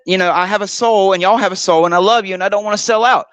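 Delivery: normal talking voice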